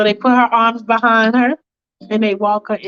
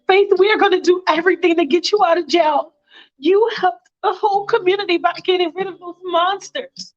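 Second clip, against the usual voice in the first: higher, quick eager mom voice; a grateful church mother reassuring Faith; Katie's mother; 7 semitones higher, faster than usual. Retold or imitated. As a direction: rush out the support. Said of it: "She's like".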